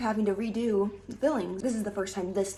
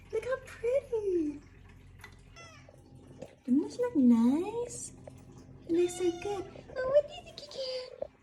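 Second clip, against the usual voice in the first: cooing; 13 semitones higher than usual. Real, not acted. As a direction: coo at it lovingly. Cooing at pretty food.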